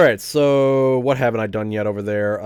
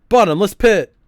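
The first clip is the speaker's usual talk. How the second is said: excited exclaim; 9 semitones higher, 8 dB louder than usual. Real, not acted. Player exclaims at game.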